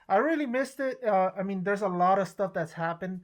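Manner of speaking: usual speaking voice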